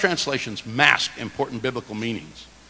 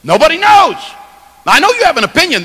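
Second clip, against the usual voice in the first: preaching shout; 14 semitones higher, 9 dB louder, faster than usual. Real, not acted. Preacher at peak.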